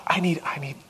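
unvoiced whisper, a private admission; whispered confession. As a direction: breathe it out like a secret. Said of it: "I'm like".

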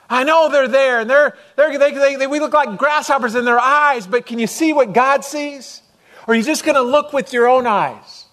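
high, loud and pleading, as Caleb urging the people; urgent Caleb voice. Acted. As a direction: plead like Caleb facing the giants.

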